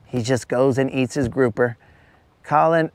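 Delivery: ordinary delivery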